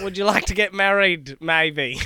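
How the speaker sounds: put-on Australian accent. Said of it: "mine shifts between".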